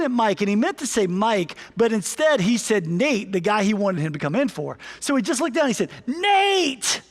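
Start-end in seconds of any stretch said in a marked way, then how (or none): 6.1-7.0 retold coach yell. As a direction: call him off the bench, high and sharp. Coach yells down the bench.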